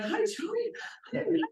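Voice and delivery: very high-pitched cooing hello; sweet-talking greeting. Retold or imitated. Greeting the missing brother on the phone, sweet-talking.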